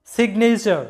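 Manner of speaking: সিগনেচার with a sounded G closing সিগ before the N; G pronounced. Shown as a demonstration. Teacher explaining the G is sounded, সিগনেচার with audible G. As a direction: let the G be heard in সিগনেচার. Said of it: "তাই জি এর উচ্চারণটা হবে".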